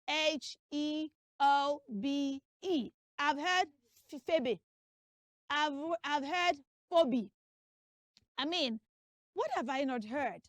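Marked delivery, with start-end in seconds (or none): 4.1-4.6 Phoebe as "feh-beh"; wrong pronunciation. Shown as a demonstration. Teacher reports a mistake heard, Phoebe as "feh-beh". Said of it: "That name is not Phoebe". 6.9-7.3 Phoebe as "foh-bee"; wrong pronunciation. Shown as a demonstration. Teacher reports a mistake heard, Phoebe as "foh-bee". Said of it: "It is not Phoebe".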